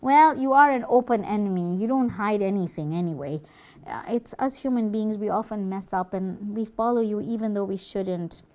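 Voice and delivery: ordinary talk, nothing marked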